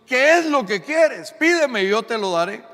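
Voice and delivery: preacher's loud divine offer; God's offer proclaimed. Acted. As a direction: boom it out as God's promise. God speaks to Salomón, preached climax.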